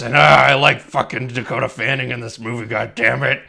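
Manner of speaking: loud, cranky actor impression; hammed-up irritable old actor; Bruce Dern. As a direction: grumble crossly like a crotchety screen veteran. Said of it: "Bruce Dern".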